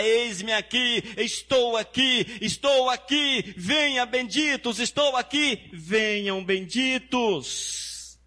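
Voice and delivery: high, slow, drawn-out divine call; voice of God calling. Acted. Pastor voicing God inviting the blessed, slow and exalted. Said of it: "ele vai falar".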